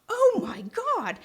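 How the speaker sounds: high, slow horrified cry; shocked dad. Retold or imitated. Dad sees his kids under the plywood, horrified cry. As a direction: gasp it out in sudden horror.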